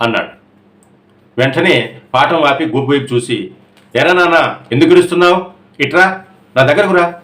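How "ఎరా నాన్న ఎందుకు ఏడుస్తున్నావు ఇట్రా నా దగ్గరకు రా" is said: gently coaxing, raised pitch; soothing. A narrator's reading. Teacher calling a crying pupil over, gently coaxing. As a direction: comfort the crying boy and call him close. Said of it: "అన్నాను ఊరడింపుగా".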